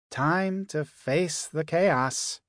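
usual speaking voice

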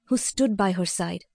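ordinary delivery, nothing marked

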